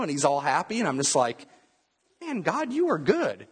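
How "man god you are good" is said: much higher, exclaiming thanks to God; joyful praise. Retold or imitated. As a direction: burst out in amazed gratitude. Praising God after answered prayer.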